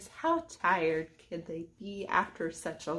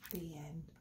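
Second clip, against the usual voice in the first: hushed quiet close; soft ending; 4 semitones lower, 17 dB quieter than usual. A narrator's reading. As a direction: close the book gently.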